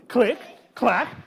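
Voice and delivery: plain reading voice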